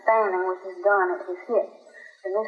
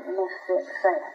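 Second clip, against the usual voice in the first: ordinary delivery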